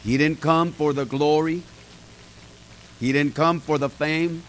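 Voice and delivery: ordinary delivery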